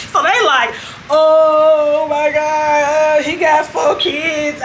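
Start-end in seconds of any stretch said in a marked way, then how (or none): 1.1-4.6 slow, drawn-out oh my God; mock shock. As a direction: stretch it out like scandalized women. Women shocked at four kids.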